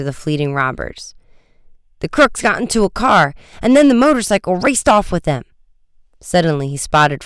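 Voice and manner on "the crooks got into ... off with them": higher, agitated telling; hurried, worked-up witness; excited report. A narrator's reading. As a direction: blurt it out to the police. Guard's excited report of robbery.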